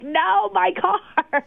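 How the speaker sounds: high, drawn-out dismayed cry; stretched-out mock despair; mock wail. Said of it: "screaming, crying".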